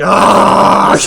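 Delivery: loud, high drawn-out yell; long exaggerated "aah" of dismay; mock despairing scream. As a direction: re-enact a frustrated scream. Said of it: "and we're like".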